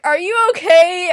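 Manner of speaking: loud, high-pitched check on someone; mock alarmed concern. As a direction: exclaim it in exaggerated worry. Acts out concern after kicking someone.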